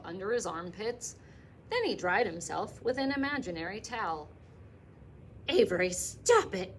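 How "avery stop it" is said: louder scolding call; mother scolding her clowning son; mother crying out. Acted. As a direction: snap at the boy to stop. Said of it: "cried his mother".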